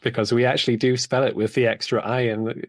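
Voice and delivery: ordinary talk, nothing marked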